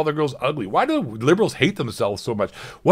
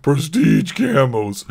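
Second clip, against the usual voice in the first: high, loud sarcastic mimicry; mock article voice; 8 semitones higher, 4 dB louder than usual. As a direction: sneer back the article's line. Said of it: "Players will be hoping that".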